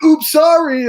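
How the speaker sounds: high, loud comic "oops"; badly faked accident, sung out brightly; mock apology. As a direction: imitate a hammed-up fake-innocent apology. Retells character's fake-accidental intrusion.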